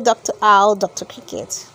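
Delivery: ordinary delivery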